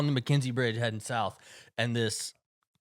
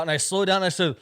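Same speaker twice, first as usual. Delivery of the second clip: usual speaking voice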